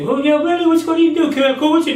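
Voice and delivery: high, pushy prying voice; high prying voice of a coworker; mock nosy coworker. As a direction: pitch it way up, prying.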